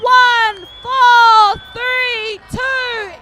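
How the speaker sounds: loud, high live play-by-play building to a roar; excited race call. Real, not acted. Commentator calling the waka final to viewers, rising into shouting. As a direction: call the finish like the crowd's on its feet.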